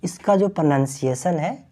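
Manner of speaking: ordinary delivery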